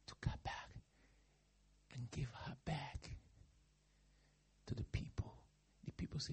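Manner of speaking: ordinary talk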